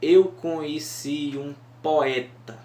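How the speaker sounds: "Eu conheci um poeta" said slowly, beat by beat; scanning syllables. Shown as a demonstration. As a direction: read it as verse, counting beats. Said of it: "ela está em sete sílabas".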